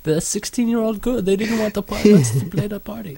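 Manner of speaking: high whiny retelling. Acted. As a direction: pitch it up like a sulking teen. Whiny retelling of band cancellation.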